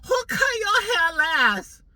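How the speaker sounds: high, slow, put-on stylist voice; nosy hairdresser in a high mock voice. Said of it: "she goes".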